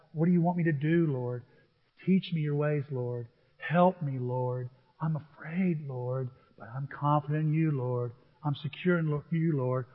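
soft, low, devoted prayer; quiet prayer. Acted. Worshipper praying the psalm: soft and low. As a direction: pray it gently, close to God.

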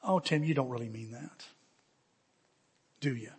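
soft, low, tender reproof; gentle shepherd. Retold or imitated. Good Shepherd reassuring him after meltdown, gentle reproof. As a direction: chide softly and lovingly, voice dropped. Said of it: "the Good Shepherd reminds me".

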